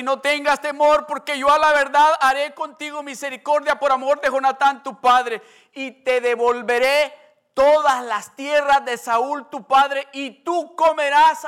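loud preacher proclaiming the promise; preaching at full force. Acted. (predicación fervorosa y fuerte)

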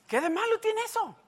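mock-innocent child's voice; innocent boy voice. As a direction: play the cheeky boy who did nothing. Imitating his young self.

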